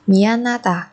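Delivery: ordinary talk, nothing marked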